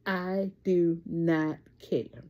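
unhurried, landing on do; drawn out, firm and final; slow deliberate catchphrase. Real, not acted. Vlogger to viewers, slow firm catchphrase.

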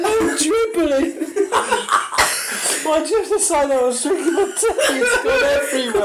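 laughing. Real, not acted. Tea spilled from laughing.

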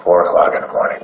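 hushed, breathy repeat of the hour; secret whisper. Shown as a demonstration. Repeating his big secret hour to the host, whispered.

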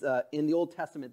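usual speaking voice

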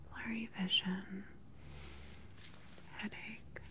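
usual speaking voice

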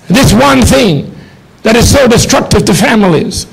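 usual speaking voice

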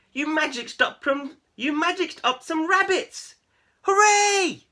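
high, loud squeals of delight; cheering. A narrator's reading. Cats celebrating Keith's magic, high loud cheer. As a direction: cheer him wildly. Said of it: "the other cats cheered".

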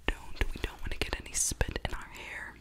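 ordinary talk, nothing marked

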